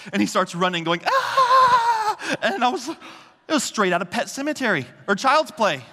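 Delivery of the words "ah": put-on high, loud gleeful toddler scream; wild toddler scream. Toddler running off with knife, high loud gleeful scream. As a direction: let out a shrieking war cry like a toddler.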